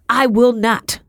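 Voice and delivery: shouted refusal. A narrator's reading. Wife refusing her husband's order, shouting defiantly. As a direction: refuse loudly and firmly. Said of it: "she shouted".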